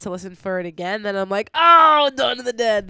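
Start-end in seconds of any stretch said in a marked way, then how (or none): 1.5-2.9 excited realization. Retold or imitated. Sudden recognition of movie clip.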